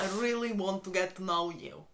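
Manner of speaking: put-on low Russian-accented line. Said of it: "I'm just gonna say Russian".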